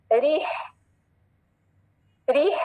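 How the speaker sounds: रीह trailing into a long audible exhale; long ee, then mid-throat breath in रीह. Shown as a demonstration. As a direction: push air out on the final h of रीह. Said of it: "ये आपको breathing sound होना चाहिए".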